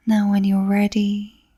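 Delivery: slow, calm meditation voice; guided meditation. Acted. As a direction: ease the listener into relaxation, drawing out words with long pauses.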